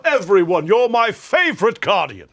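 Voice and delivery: loud, high, booming game-commander impression; Shaxx. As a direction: proclaim it slowly like a bombastic commander. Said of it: "read that in fucking Shax's voice".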